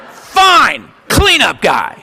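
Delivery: sulky, resigned acceptance; grudging. Retold or imitated. Recovering drunk grumbling at his new job. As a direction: give in with a grumble. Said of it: "I'm like".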